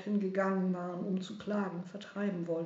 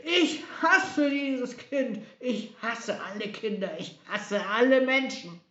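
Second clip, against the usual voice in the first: crazed, emphatic shout of hatred; mad screaming; 4 semitones higher, 7 dB louder than usual. A narrator's reading. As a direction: rage like a mad king. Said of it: "sein Wahnsinn und er schrie".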